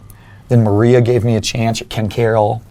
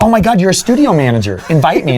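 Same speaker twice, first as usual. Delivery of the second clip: much higher, eager begging; excited plea; 7 semitones higher, 3 dB louder than usual. Retold or imitated. Student begging the studio manager for an invite, high and excited. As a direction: pitch it up, thrilled and pleading.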